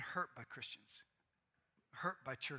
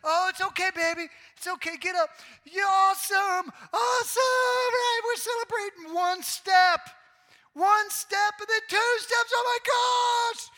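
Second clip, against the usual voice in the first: slow, squealing cheers, far higher and louder; ecstatic cheering parent; 19 dB louder, slower than usual. Retold or imitated. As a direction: celebrate every tiny step like a parade. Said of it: "and we're like".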